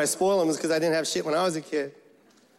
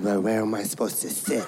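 much lower, subdued question; meek low plea; 11 semitones lower than usual. Retold or imitated. New kid to a hostile seatmate, low and meek. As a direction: ask it low, as the kid with nowhere to go. Timid kid asking bully for seat.

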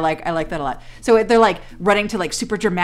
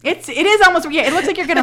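1 excited agreement; 9 semitones higher, 6 dB louder, faster than usual. Real, not acted. Excited agreement, louder higher.

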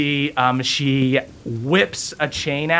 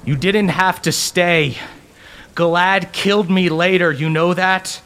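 a lightly louder ghostly voice; booming voice; 6 semitones higher, 3 dB louder than usual. Acted. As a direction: boom it from beyond the grave. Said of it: "you hear a booming voice go".

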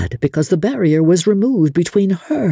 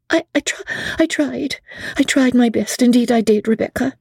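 much higher, stammering and pleading; Amelia pleading; 10 semitones higher than usual. A narrator's reading. Amelia defending herself to Becky, stammering plea. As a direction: fragile young widow, faltering.

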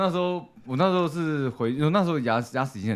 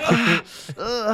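高音的哀哼; 模仿痛到哼出声; 痛苦呻吟; 7 semitones higher than usual. Retold or imitated. 模仿痛到哀叫. (模仿牙痛时带哭腔的哼声)

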